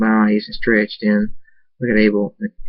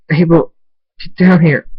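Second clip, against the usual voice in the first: higher, louder call up the stairs; 7 semitones higher, 6 dB louder than usual. Acted. Thundy calling Abel down through tears, loud. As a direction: call him down, voice straining.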